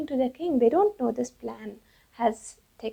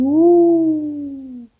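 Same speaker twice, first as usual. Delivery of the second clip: loud, drawn-out high "woo"; owls' swooping cry; long owl hoot; 4 semitones higher, 10 dB louder than usual. Acted. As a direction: let a big swooping hoot ring out. Owls flying out, sound acted.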